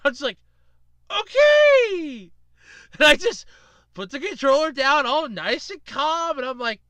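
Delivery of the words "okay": high, loud, drawn-out okay; hammed-up wary reaction to a maniac; taken aback. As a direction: stretch it out as if backing off. Reacts to the raging winner.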